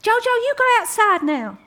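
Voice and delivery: high, loud call from afar; hammed-up high calling voice; mock shout across the holler. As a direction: imitate the landlady calling across the valley. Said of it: "you can shout across the".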